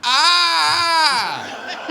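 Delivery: long high-pitched wail; drawn-out mock baby scream; newborn's cry. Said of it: "guy born with sunglasses on".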